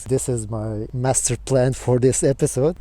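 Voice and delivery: ordinary delivery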